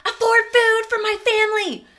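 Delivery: loud, high-pitched furious rant; piping angry. Retold or imitated. Venting to her sister about affording food, piping angry. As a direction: shout your outrage as you relive it. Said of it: "I was just piping angry".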